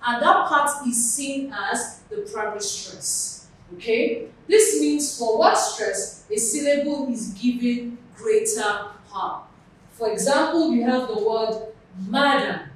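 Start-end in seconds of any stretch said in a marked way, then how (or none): none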